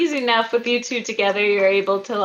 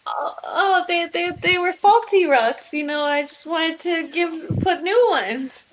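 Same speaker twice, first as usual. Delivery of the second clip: higher voice, stammering cover story; flustered caught wife; 8 semitones higher than usual. Acted. Wife explaining away the faulty rocks, flustered. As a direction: scramble for an excuse, pitched up.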